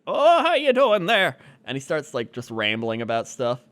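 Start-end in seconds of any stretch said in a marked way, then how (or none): 0.1-1.4 high, loud cheery dad voice; hammed-up cheery father; jolly dad. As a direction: greet him like an overbright dad. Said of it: "he's like".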